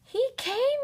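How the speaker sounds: very high thrilled cry. Acted. Oliver discovering Santa's visit, thrilled high cry. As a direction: burst out with joy. Children find Santa came.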